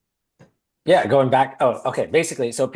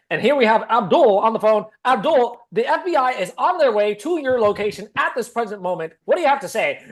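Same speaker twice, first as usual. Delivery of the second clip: high, loud newsreader delivery; news anchor voice; 9 semitones higher, 4 dB louder than usual. Retold or imitated. Woman addressing the scam caller on air, in a high anchor voice. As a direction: announce it like breaking news. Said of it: "her broadcasting".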